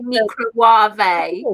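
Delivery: "microwave" said as "meekro-wah-vay"; imitating odd pronunciation. Friend disputing a TV cook's word, "microwave" as "meekro-wah-vay". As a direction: pronounce "microwave" with a mock "wah-vay" ending. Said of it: "listen to the way Nigella says microwave".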